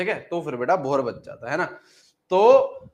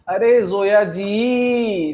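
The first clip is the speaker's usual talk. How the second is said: long drawn-out, raised-pitch, louder call; exasperated calling out; 4 semitones higher, 5 dB louder, much slower than usual. Real, not acted. Teacher answering a student's doubt, drawn-out exasperated call. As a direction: stretch the name out in loud protest.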